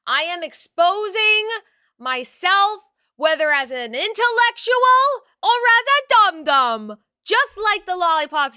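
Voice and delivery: big showy hype to chat; hyped announcement. Real, not acted. Streamer hyping chat about her quiz test, loud and high.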